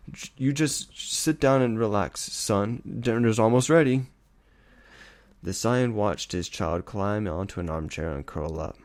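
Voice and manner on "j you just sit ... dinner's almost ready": higher, louder forced brightness; strained cheer. Acted. Scared father urging his son to relax, strained. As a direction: push a cheerful tone over fear.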